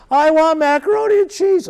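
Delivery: put-on high, squeaky kid asking; a child demanding mac and cheese. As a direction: play the whiny little kid. Presenter voices a child's request.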